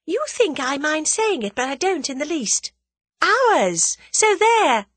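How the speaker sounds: high, building to a loud retort; spirited defiance, pitched well up; defiant. A narrator's reading. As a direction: throw it at him defiantly. Heroine defiantly admits her love.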